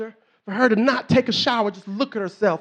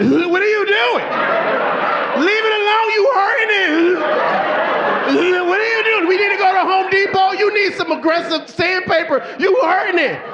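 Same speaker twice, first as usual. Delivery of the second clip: high-pitched, loud mock horror; alarmed protest; 7 semitones higher, 3 dB louder than usual. Husband watching his wife scrub too hard, high alarmed yelling. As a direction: yelp it in comic disbelief.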